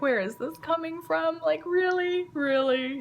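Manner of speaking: high, incredulous questioning; mock-astonished mom; taken aback. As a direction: act out baffled disbelief, pitched well up. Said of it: "taken back completely".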